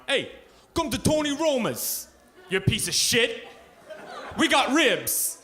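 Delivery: high, loud mock mobster pitchman; Sopranos tough guy. Imagined Tony Roma's ad guy yelling at the camera, hammed up. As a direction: ham up a loudmouth New York restaurant ad. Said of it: "I picture this Sopranos kind of guy".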